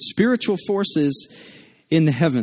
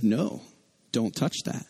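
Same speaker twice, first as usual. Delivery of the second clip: low, hushed parental no; retold dad's soft but firm reprimand; quiet firm warning; 6 semitones lower, 9 dB quieter than usual. Dad correcting his toddler at the outlet, quietly firm.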